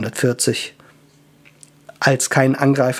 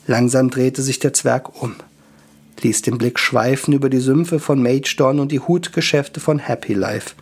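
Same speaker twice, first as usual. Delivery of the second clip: plain reading voice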